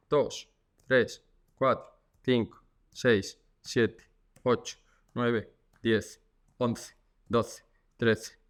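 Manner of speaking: slow, steady counting to hold a stretch; exercise count. Shown as a demonstration. Physio counting seconds for viewers doing the exercise, slow and steady.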